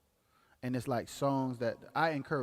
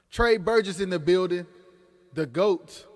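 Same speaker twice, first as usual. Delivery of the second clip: excited hype; 5 semitones higher, 10 dB louder than usual. Real, not acted. Hyping an artist on stream.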